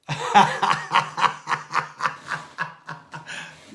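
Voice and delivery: loud airy wheeze of laughter; wheeze-laughing, can't stop; breathy wheezing laugh. Real, not acted. Laughing at the terrible box.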